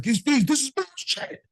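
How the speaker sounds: high, slow mock outrage at the fees; irate client. Furious client rants to notary about loan fees, high mock outrage. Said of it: "he is going ballistic".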